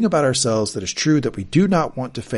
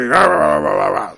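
loud mock ranting rah rah; villain rant grumble; 3 semitones higher, 8 dB louder than usual. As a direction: bluster wordlessly like a tyrant. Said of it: "like the Emperor".

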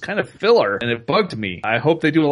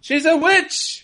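mock accuser; much slower than usual. Mock Monty Python witch accusation.

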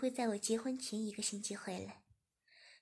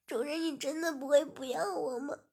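tearful question; 4 semitones higher, 5 dB louder than usual. Acted. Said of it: "擦了擦眼泪便说".

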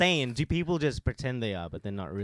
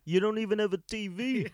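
higher teasing jab at a friend; mocking quip; 9 semitones higher than usual. Lam mocking a friend who has no TV, higher teasing jab. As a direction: tease him, pitched up. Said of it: "Lam was like".

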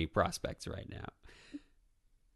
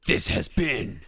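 announcer voice; 4 semitones higher, 6 dB louder than usual. Pretend. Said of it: "we need to do a real one".

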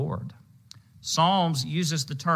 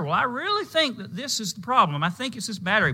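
earnest car owner; 9 semitones higher, faster than usual. Pretend. Voicing the sincere car owner.

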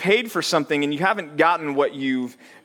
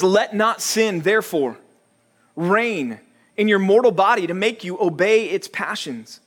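a touch higher, measured scripture read; 4 semitones higher than usual. Acted. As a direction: read the verses with solemn weight. Reading scripture.

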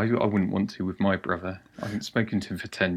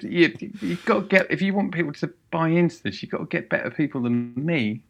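retold advice; 6 semitones higher than usual. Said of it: "I said".

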